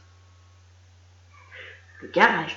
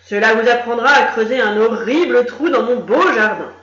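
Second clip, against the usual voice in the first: put-on loud, pleased grumpy old man; gloating grump; 7 dB louder than usual. A narrator's reading. Gronchon crows over the trapped rabbits, loud and gloating. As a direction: crow over the blocked burrow. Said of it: "dit-il en se frottant les mains".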